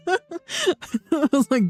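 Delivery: high burst of laughter; laughing. Real, not acted. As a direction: laugh it out and keep talking. Laughs at own story.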